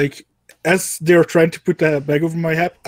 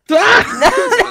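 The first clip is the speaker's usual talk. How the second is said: frustrated yelp; 5 dB louder than usual. Real, not acted. Fumbled sleight of hand roll.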